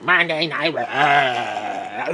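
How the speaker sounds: slow, drawn-out mocking Stephanie; hammy Stephanie announcer voice; Stephanie impression. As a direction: drag out Monday Night Raw like a grating announcer. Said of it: "her voice is like".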